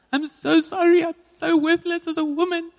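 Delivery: high-pitched, distraught apology; imitating a desperate, pleading wail; re-enacted breakdown. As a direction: act out breaking down while apologising. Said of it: "often break down and repent to my husband".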